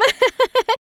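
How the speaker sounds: short happy laugh; delighted giggle. Acted. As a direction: giggle at the treasure. Maid pleased by treasures.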